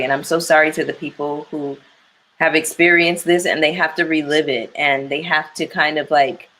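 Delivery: normal talking voice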